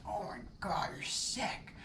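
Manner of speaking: gasped high whisper of disgust; shocked whisper. Pretend. Imagined listener whispering in horror at him. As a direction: hiss it like you're appalled.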